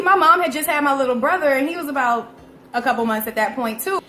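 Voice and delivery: ordinary talk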